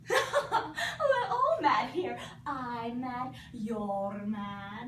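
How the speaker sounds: gleeful laugh through the words; laughing cat. Acted. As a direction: cackle it out as the grinning cat. Cheshire cat's mad laugh.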